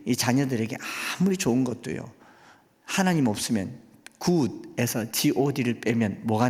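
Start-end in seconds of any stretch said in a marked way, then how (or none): none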